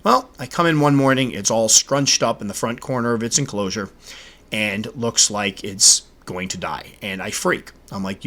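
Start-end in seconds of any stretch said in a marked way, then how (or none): none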